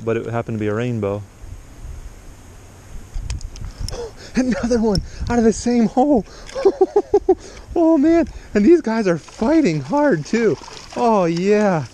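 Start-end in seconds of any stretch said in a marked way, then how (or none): 2.9-4.3 high breathless gasp of surprise; sharp gasp. Real, not acted. Another fish on the line. 4.3-11.9 high, loud thrilled exclaiming; real excitement. Real, not acted. Landing another fighting trout.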